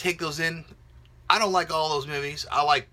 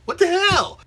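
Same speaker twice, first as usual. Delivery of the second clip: even higher, louder What the hell, near a shriek; exasperated; 8 dB louder than usual. Real, not acted. Vlogger baffled by fans hating unseen films, shrill outburst. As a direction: repeat it with more outrage.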